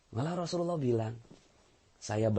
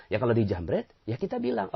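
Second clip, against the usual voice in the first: usual speaking voice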